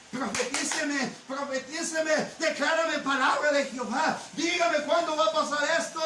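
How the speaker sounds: hammed-up churchgoer loudly demanding a prophecy; pleading demand for prophecy. Preacher mocking members who demand prophecy, loud insistent begging. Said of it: "Hay hermanos que están".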